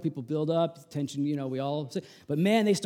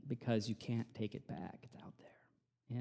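hushed, much softer whisper, slowing at the end; 5 semitones lower, 13 dB quieter than usual. Acted. Preacher warning the congregation about hurtful words, whisper.

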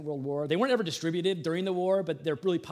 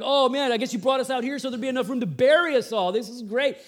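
louder, fast sarcastic whining; drama mama; 6 semitones higher, 6 dB louder, faster than usual. Retold or imitated. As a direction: overdo the doom like a drama mama. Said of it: "drama mamas".

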